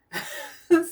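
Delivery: high burst of bewildered laughter; surprised laugh. Real, not acted. Psychic puzzled by the spirit's popcorn message, laughing.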